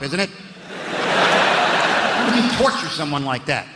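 ordinary talk, nothing marked

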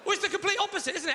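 normal talking voice

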